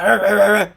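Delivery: loud imitated caveman grunting; caveman grunts. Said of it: "talked like him".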